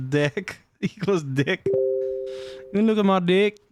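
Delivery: hammed-up Kiwi vowels; attempted Kiwi accent. Shown as a demonstration. Streamer joking with the Kiwi vowel in deck, mock Kiwi accent. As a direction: play with a badly faked Kiwi accent. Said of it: "What is a Kiwi accent".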